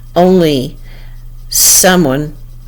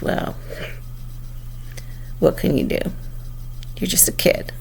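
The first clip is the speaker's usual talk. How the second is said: whispered retort; 2 semitones lower, 11 dB quieter than usual. Retold or imitated. Girl taunts her quietly.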